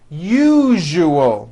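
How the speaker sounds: teacher repeating the model. Shown as a demonstration. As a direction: say it smoothly. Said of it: "Now put it together".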